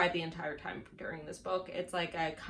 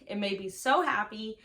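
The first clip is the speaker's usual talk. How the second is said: ordinary talk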